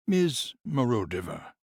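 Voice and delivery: slow, careful address; slowly. Acted. Conrad carefully addresses the nurse, slowly. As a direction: draw the name out slowly. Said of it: "he said slowly".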